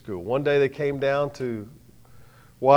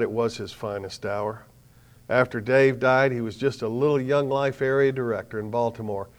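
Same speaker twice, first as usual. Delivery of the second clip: normal talking voice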